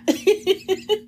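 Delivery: bright squealing laugh; high laugh. Real, not acted. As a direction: break into laughter. Laughing at the story.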